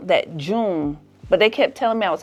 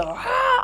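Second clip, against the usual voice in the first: imitated woman's scream. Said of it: "I heard this woman go".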